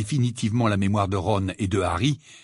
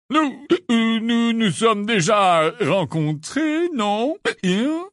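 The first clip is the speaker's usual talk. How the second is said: high, slow, hesitant character voice; puzzled amnesiac; 10 semitones higher, 4 dB louder, much slower than usual. Acted. Lockhart asks Harry whether they have met, puzzled. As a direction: grope for a memory, questioning.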